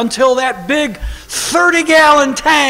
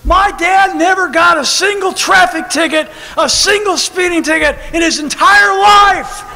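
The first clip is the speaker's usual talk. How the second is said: amazed preaching; 6 semitones higher, 4 dB louder than usual. Real, not acted. Preacher marvels at dad.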